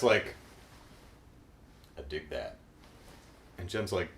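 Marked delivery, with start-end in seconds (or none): 2.0-2.6 much lower and softer, laid-back; smooth rock star approving, low key; cool rocker. Retold or imitated. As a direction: purr it like a smooth dude. Riot being cool.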